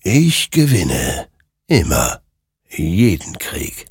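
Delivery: slow, drawn-out creature voice; ominous creature. A narrator's reading. Creature answers Hector on the warhorse, slow and ominous. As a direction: let each word hang, slow and ominous.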